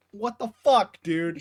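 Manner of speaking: loud, high acted protest; indignant outburst. Boggle confronting the one who hit him, indignant outburst. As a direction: burst out in outraged disbelief. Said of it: "He's just like".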